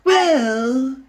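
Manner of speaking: hint of a sneering villain, slow; Joker imitation. Host mocking how Joker should sound, lightly voiced villain. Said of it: "Joker sounds more like".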